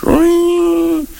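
high, drawn-out imitated phone ring. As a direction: imitate a shrilling telephone on one high note. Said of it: "the phone rings".

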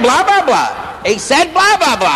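high, incredulous mock outrage; scandalised judge. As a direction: repeat it high, drawing out the blahs. Judge repeating the word in shock.

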